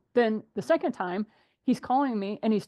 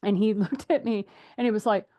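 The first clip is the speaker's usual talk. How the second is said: normal talking voice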